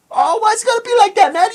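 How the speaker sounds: squeaky high voice, pleading weakly; mock cowardly high whine; high whiny plea. Scared inmate pleading with the one threatening him, squeaky high whine.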